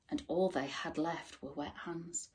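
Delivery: plain reading voice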